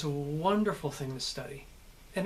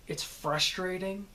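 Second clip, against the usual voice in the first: slow, sharp American syllables; direct, drawn-out American "frustrating"; American accent; 2 semitones higher than usual. Shown as a demonstration. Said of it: "it's very hard on the syllables".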